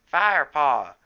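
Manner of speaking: loud, high call of a name; sudden shout. A narrator's reading. Graypaw shouting a warning to Firepaw, sudden loud call. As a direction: yell it out in alarm. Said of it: "Graypaw's voice made Firepaw jump".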